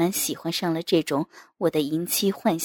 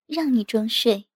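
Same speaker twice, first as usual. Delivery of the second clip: higher, playful mock scolding; teasing wife; 5 semitones higher, 5 dB louder than usual. A narrator's reading. Wife catching her husband feigning sleep, teasing. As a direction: tease in a lightly voiced wife's tone.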